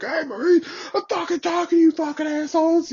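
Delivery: hammed-up furious first sergeant calling them out; imitating a yelling sergeant. Sergeant runs over yelling at them.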